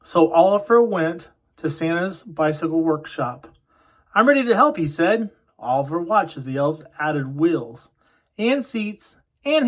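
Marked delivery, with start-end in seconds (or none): 4.1-4.8 high, eager offer. Acted. Oliver eagerly offers his dad help, high and bright. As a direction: chirp it up, keen to help. Eager little elf volunteers.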